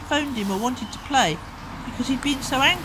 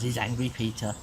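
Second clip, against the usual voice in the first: plain reading voice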